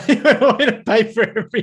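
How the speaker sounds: laughing while repeating it. Real, not acted. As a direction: say it through laughter.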